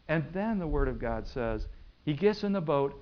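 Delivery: ordinary delivery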